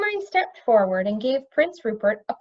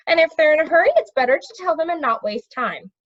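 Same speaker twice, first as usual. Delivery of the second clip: put-on higher, louder young prince voice; boy prince; 7 semitones higher, 5 dB louder, faster than usual. A narrator's reading.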